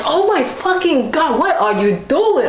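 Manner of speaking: high outraged cry of pain; shocked outcry. Retold or imitated. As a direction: protest in shock as he presses. Pain when doctor presses.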